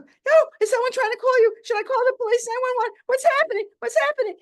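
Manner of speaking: high, loud panicky questions; panicked doctor. Retold or imitated. Doctor on the phone fearing a break-in, panicking.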